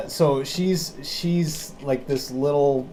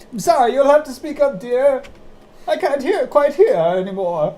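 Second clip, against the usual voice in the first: put-on high, loud old lady voice, a bit fast; a little old lady straining to hear; deaf old lady; 11 semitones higher, 4 dB louder than usual. GM voices hard-of-hearing old lady.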